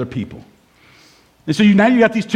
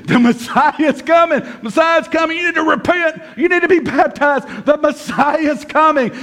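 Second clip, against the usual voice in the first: high, urgent proclaiming cry; imitated preacher, pitched up and insistent; street preaching; 7 semitones higher than usual. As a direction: call out like an evangelist on a corner. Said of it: "they're out there preaching".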